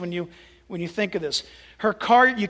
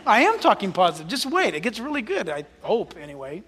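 ordinary talk, nothing marked

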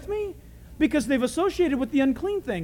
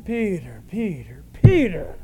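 building exasperation; 3 semitones lower, slower than usual. Acted. Jesus chiding Peter for refusing, increasingly exasperated. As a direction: repeat it slowly, bursting out on the last.

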